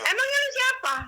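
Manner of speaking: very high-pitched retort, re-enacted; indignant challenge. Repeating her retort to the voice, indignant. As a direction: challenge them sharply.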